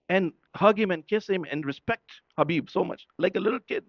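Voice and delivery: normal talking voice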